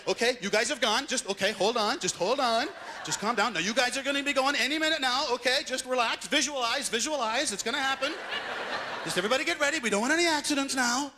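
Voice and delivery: high-pitched, coaxing official voice; patronizing reassurance. Acted. Government voice telling waiting drivers to relax, high patronizing reassurance. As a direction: soothe them like fidgety children, pitched high.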